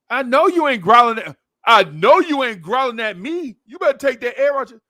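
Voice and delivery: high, loud, cheeky pup talking back; sassy puppy. Retold or imitated. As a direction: squeal it like a tiny dog picking a fight. Puppy talking back to the big dog.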